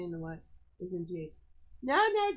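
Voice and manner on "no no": quick high loud "no, no" to stop a pet; sharp no. Real, not acted. Woman scolding her cat Jade to stop, high and loud.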